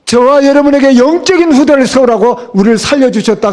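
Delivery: normal talking voice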